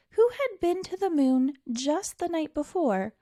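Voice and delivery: usual speaking voice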